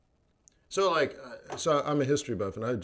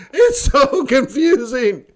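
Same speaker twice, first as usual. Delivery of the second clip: much higher and louder, laughing whine; mock whine; 12 semitones higher, 11 dB louder, slower than usual. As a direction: wail it like a lost tourist half laughing. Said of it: "And you're like".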